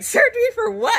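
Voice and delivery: high, loud clueless squeal; giggly high-pitched question; giddy sedated self. Retold or imitated. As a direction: imitate yourself loopy and oblivious.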